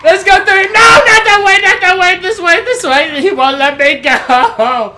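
loud frantic directions; urgent panicked shouting while fleeing; being chased. Real, not acted. Fleeing the attacker.

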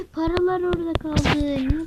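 gasp, then high-pitched dismay; dismayed outcry. Real, not acted. Gamer sees the money left behind, dismayed outcry. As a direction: cry out over a sudden loss.

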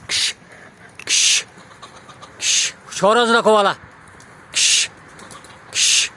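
loud sharp shoo calls; shooing shout. Real, not acted. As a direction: shout to chase off the birds. Shooing chickens and rooster, shouting.

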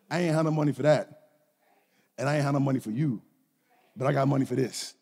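low, deadpan dad put-down; dry comeback. Retold or imitated. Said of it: "I looked at him, I said".

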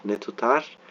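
ordinary talk, nothing marked

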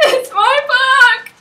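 very high, very loud, slow crying squeal; real joyful sob-squeal on book; tearful squeal. Real, not acted. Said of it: "Sorry for the high-pitched squealing".

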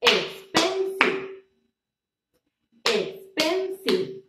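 slow expensive, PEN punched; stress on PEN. Shown as a demonstration. Teacher claps expensive, stress on PEN. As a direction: lean on the middle of expensive. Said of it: "Is in the middle".

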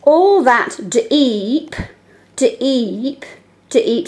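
very slow, stretched reading. Shown as a demonstration. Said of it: "We can hear it three times".

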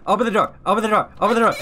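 usual speaking voice

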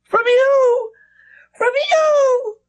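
put-on high, loud wailing child; cried. A narrator's reading. Flora crying at the governess, high and loud. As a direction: hurl it back at her, crying. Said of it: "she cried".

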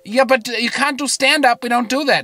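high, loud German caricature; Annika. Acted. Girlfriend explaining the show's rules, hammed-up German accent. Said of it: "I do an impression of Annika".

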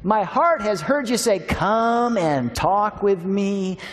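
high, loud, slow prayerful reading; fervent prayer reading. Acted. Pastor praying the psalm over the congregation, slow and fervent. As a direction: lift the psalm up as a plea.

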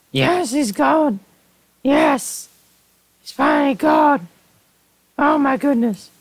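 high, drawn-out cries of triumph; jubilant squeal. Real, not acted. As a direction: squeal with huge relief and joy. Celebrates that he is finally gone.

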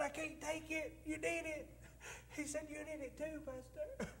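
soft, high, real tearful voice. Real, not acted. Pastor recalls a member's gift of money, tearful. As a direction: choke up softly, voice lifted. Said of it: "use up your whole Kleenex box".

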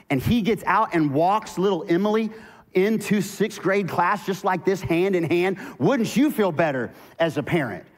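loud, high, driving sermon voice; fiery preaching. Acted. Pastor preaching hard to the congregation, loud and driving. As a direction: preach it out with full force.

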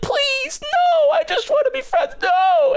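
a tiny plush toy shrieking for its life; cutesy begging toy. Retold or imitated. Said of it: "in this, like, cutesy little voice".

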